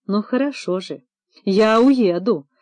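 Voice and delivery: plain reading voice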